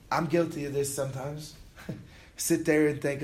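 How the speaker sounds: ordinary talk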